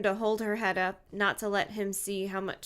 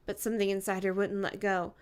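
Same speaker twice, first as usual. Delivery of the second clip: usual speaking voice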